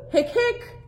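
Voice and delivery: hiccup sounds, very high; squeaky hiccups. Acted. Hector hiccups.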